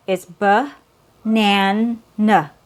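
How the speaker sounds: banana split into b, nan, n with neutral weak ends; syllable by syllable. Shown as a demonstration. Teacher breaking banana into syllables. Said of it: "same idea".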